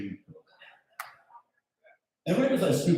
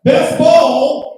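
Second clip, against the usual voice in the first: full-throated shout, much higher; 12 semitones higher, 15 dB louder than usual. Real, not acted. Comic leading the crowd callback, big shout. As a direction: belt it out to the room. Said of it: "Let's try that one time".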